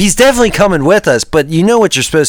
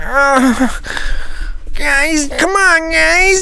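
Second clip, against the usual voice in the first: high pleading voice; 8 semitones higher than usual. Pretend. Masked figure pleading, gifts.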